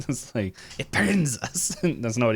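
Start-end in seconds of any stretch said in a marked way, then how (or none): 0.8-1.8 high-pitched creature recoiling from light; playful hissing complaint, pitched up; mock hiss. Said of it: "as he hisses".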